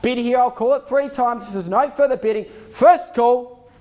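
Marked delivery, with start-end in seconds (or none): 2.7-3.7 calling out. Real, not acted. Auctioneer calling first call.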